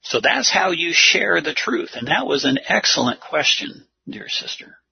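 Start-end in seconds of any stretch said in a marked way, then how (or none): none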